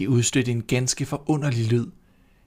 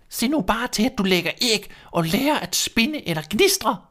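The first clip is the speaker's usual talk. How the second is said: high, bossy hen voice; a fussy hen nagging; hen's voice; 10 semitones higher, 3 dB louder than usual. A narrator's reading. Hen nagging the restless duckling, high and bossy.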